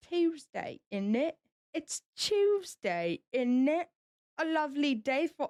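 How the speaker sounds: warm up into a British accent. Shown as a demonstration. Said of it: "I have to do it British".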